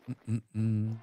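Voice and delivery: usual speaking voice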